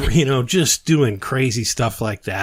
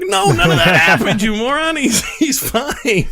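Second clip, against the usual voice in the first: higher, louder teasing scorn; mocking scorn; 12 semitones higher, 4 dB louder than usual. Telling the gullible friend it never happened, higher mocking laugh. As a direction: laugh at him for still believing it. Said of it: "I'm like".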